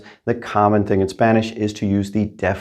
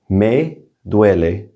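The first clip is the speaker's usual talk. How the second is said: me duele drawn out, each syllable clear; slow model answer; much slower than usual. Shown as a demonstration. Teacher to students, modelling a slow clear answer. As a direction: say me duele slowly for learners. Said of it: "We would answer this question by saying".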